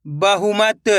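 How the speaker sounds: ordinary talk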